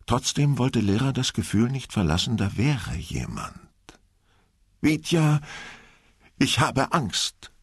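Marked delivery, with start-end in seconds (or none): 4.8-7.5 higher, slow, scared plea; woman in a dark ghost ride admits she is scared, high and slow; frightened blurt. Acted. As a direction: push out the fear in a small voice to her boyfriend. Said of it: "stieß Lehrer hervor".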